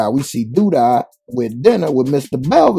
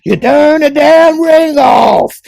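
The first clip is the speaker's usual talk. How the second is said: grandma yelling; 10 dB louder, slower than usual. Retold or imitated.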